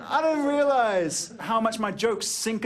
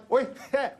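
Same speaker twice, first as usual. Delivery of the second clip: put-on kid voice; 5 semitones higher than usual. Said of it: "were like".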